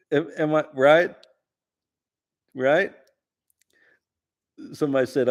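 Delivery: normal talking voice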